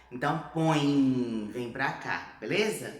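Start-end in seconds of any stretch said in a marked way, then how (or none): 0.5-1.5 PÕE loud and drawn out through the nose; nasalised. Shown as a demonstration. Teacher showing the til, nasalised. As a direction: send PÕE slowly into the nose. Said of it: "E aqui pra nasalizar".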